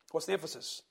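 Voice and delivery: usual speaking voice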